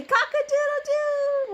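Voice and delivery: high, slow crowing cock-a-doodle-doo; rooster crow. Pretend. Mom asking toddler to crow, high and slow.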